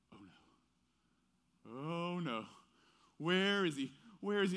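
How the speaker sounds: a dad who just lost sight of his son; oh no, where did he go; mock panic. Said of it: "And I'm like".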